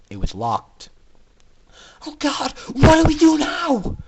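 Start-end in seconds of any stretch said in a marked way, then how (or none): 2.0-4.0 high, loud desperate wail; terrified cry. A narrator's reading. Aurora finding the door locked, terrified cry. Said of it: "Aurora cried".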